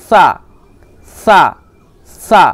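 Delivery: সা said with the tongue tip pushed out past the teeth. Shown as a demonstration. Teacher shows the tongue-out trick for সা. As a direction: push the tongue out as you say সা. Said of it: "জিভটাকে একটু বাইরে বের করে দেবেন".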